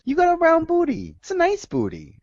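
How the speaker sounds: coworker's flirty voice. Retold or imitated. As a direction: purr it out like a teasing coworker. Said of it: "And she goes".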